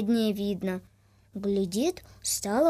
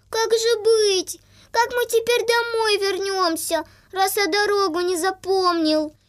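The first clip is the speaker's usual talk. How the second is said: put-on tearful little boy; 8 semitones higher, 5 dB louder than usual. Boy near tears, high.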